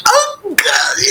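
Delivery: high mock wail; Dobby. House-elf who can't tell the secret, high anguished wail. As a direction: whimper it high in comic anguish.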